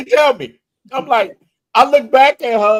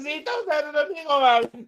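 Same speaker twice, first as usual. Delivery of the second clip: high-pitched mock Dominican accent; laughing Dominican-accented retort, pitched high; 8 semitones higher, 6 dB quieter than usual. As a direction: slip into hammed-up Dominican English. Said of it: "Look, I start talking".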